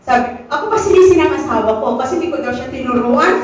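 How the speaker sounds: ordinary delivery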